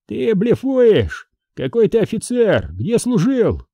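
high, disbelieving enemy soldier; challenging questioning. A narrator's reading. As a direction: challenge his claim from a distance, pitched high. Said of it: "вопрошал неизвестный собеседник". (недоверчивый вызов на высоких тонах)

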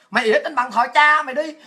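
ordinary delivery

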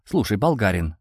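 ordinary delivery, nothing marked

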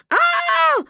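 high joyful scream; delighted yell. A narrator's reading. Girl thrown far, screaming high with joy. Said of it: "орала в восторге Каринка".

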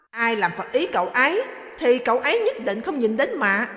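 dismissive orders to the maid; stern mother-in-law. Acted. Mother-in-law ordering the maid away, loud and curt.

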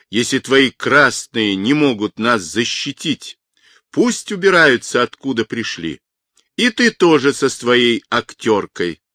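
ordinary delivery

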